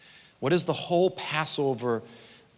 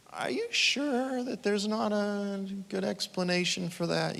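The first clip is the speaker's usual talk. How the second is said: doubting skeptic; 6 semitones higher, 6 dB quieter than usual. Retold or imitated. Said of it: "you can leave and go".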